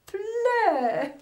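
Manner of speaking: loud, slow, stretched-out words; emphatic declaration. Acted. Woman talking about herself, loud drawn-out declaration. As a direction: declare it loudly, dragging each phrase.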